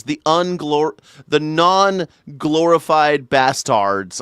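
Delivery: ordinary delivery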